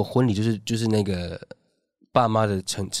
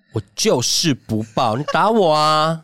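slow, louder taunting dare; mock defiance; 4 semitones higher, 4 dB louder, slower than usual. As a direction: drag it out like a cheeky dare. Defiant retort to rude assistant. (慢慢挑衅地说)

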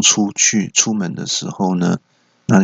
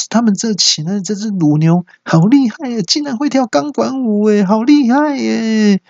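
very high, stretched mock wonder; high-pitched hammed-up amazement; feigned amazement; 13 semitones higher than usual. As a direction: gush in awe to cover up a lie. Husband feigning amazement at dancing cow.

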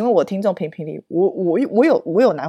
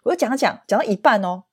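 usual speaking voice

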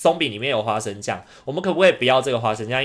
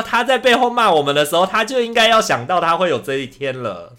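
higher, louder, heated retort; angry retort, pitch up and voice raised; retold anger; 6 semitones higher, 5 dB louder than usual. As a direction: hurl it back at the manager in anger. Retold angry reply to the manager.

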